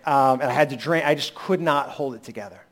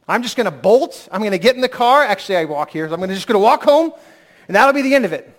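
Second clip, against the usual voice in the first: mock escape plan; 6 semitones higher, 7 dB louder than usual. Retold escapist thought, rising.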